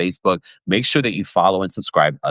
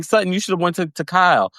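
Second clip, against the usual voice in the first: usual speaking voice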